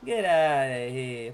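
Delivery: put-on high cocky taunting kid; mock trash talk. Challenging a viewer to duel, mock swagger.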